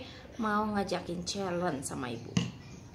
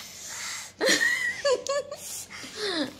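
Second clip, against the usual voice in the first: high laugh. Real, not acted. Mom laughs during spicy challenge.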